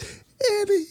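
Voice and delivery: very high, thin mock dying voice; dying mother. Anakin's mom dying to her son, mock dying voice. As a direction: imitate a mother's last tearful words in falsetto. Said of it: "she's dead".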